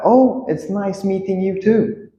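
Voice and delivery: high, polite reply; acted-out friendly reply voice; Gary. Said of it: "Now Gary would reply".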